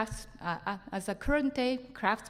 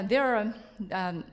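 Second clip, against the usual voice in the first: usual speaking voice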